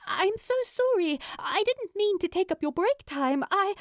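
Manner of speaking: high, fast, embarrassed rush of words; gushing sorry in a fluster; flustered apology. A narrator's reading. Flustered Steed apologizing to the bartender, high and rushed. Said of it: "immediately flustered and embarrassed".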